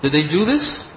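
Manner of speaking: a hint of a stern teacher demanding answers; mock stern scolding. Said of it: "chastising him".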